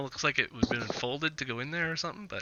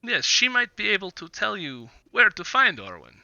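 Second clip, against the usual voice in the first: lightly voiced Vistani trader, eager to make a deal; Vistani businessman; 4 semitones higher than usual. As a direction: play a businessman making his pitch, halting between phrases. Game master voices Vistani businessman.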